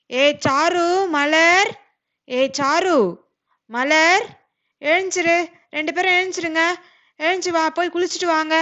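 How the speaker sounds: put-on loud calls to the sleeping children; mother shouting the girls awake; yelling to wake the kids. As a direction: bellow their names from the next room.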